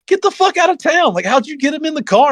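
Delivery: high-pitched mock incredulity; retold disbelief. Buddy hearing who the co-driver is, incredulous. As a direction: exclaim it in high, exaggerated astonishment.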